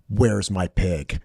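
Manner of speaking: slow, low movie-hero question; slow grim demand. Retold or imitated. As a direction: draw it out like a brooding man making a demand. Acting out Cage's demand for his pig.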